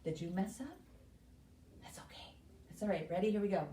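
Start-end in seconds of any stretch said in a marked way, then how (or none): 1.8-2.8 soft whisper; whispered reassurance. Real, not acted. Teacher reassuring a child who messed up, whispered. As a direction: whisper it kindly.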